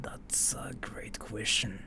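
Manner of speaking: slow, hushed whisper. Real, not acted. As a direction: lean in and breathe the words slowly. Whispers reply to chat.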